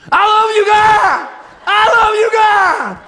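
very loud, very high shout of love; shouted praise. Retold or imitated. Retelling shouting praise alone at home, full volume. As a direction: yell it at the top of your voice. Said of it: "kept getting louder and louder".

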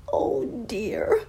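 put-on high little boy's voice with a sob in it; high sob-laden oh dear; crying boy. A narrator's reading. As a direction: whimper it like a lost child. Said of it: "heard a crying voice say".

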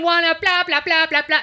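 high-pitched babbling imitation of a complainer; mock loud ranting. Imitating customer yelling during the show, mock loud rant. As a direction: mimic someone carrying on loudly. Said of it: "like, getting super loud".

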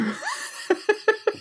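high breathy laugh. Real, not acted. Laughs at insult joke.

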